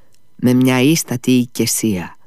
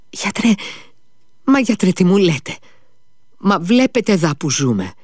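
high, despairing cry; father pleading with the doctor, despairing; despairing plea; 8 semitones higher than usual. A narrator's reading. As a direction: throw up your hands in despair. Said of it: "δείχνοντας με απόγνωση".

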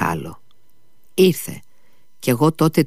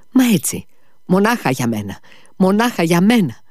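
rising outburst; 8 semitones higher than usual. Acted. Liza's outburst of self-pity.

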